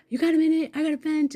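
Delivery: high, loud urgent plea to a friend; worked-up venting call. Retold or imitated. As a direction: retell it wound up, needing to vent. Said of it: "I called my best friend up".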